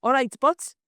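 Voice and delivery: a weak attempt at a Welsh "All right, but" rising at the end; bad Welsh accent. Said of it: "no, that was bad".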